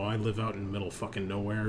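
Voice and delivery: ordinary talk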